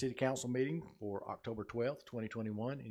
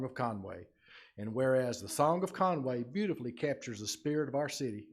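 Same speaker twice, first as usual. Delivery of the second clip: loud, formal civic proclamation; projected, official reading in measured clauses; 7 dB louder than usual. Real, not acted. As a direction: read it out to the whole room with ceremony.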